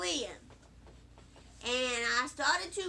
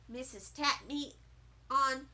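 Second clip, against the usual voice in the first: ordinary delivery, nothing marked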